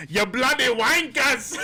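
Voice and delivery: high, drawn-out British insult; hammed-up British heckler; angry Brit impression. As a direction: yell it slowly as an outraged Brit. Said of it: "all the people in Britain are like".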